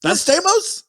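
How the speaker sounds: "Not Stamos?" with a big high rise on "Stamos"; incredulous question. Retold or imitated. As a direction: ask it in shocked disbelief, pitch soaring.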